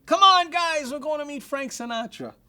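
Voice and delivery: rally the whole room from a tabletop; excited call from table. Retold or imitated. Manager on a table calling his team, high excited shout. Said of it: "jumps on top of a table".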